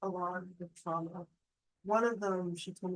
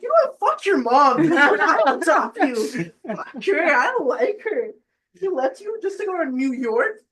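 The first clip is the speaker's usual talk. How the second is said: high, loud, laughing mock fury at the absent mom; mock furious rant; 7 semitones higher, 14 dB louder than usual. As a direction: rant on the kid's behalf, half laughing.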